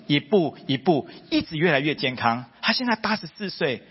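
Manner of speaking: ordinary delivery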